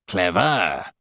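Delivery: low, gloating buzz; gloating demon. A narrator's reading. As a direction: gloat over the captive. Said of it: "Matron gloats at me".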